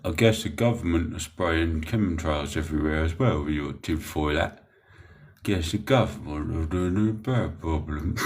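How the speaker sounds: mocking imitation. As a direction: mimic a commenter, having a bit of fun. Mocking chatters' comments, then apologises for fun.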